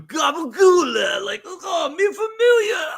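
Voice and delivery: loud, high, slow mock Italian-American shout; hammed-up Italian-American caricature shout. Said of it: "the people that are, like".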